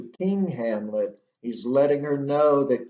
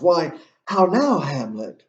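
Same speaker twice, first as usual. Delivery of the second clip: higher-pitched acted shock, falling on the name; 4 semitones higher, 4 dB louder than usual. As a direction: play a queen taken aback by disrespect. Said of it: "she's in shock".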